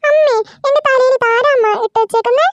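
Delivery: put-on little girl whining to her mother; child voice. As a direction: complain like a small girl to mum.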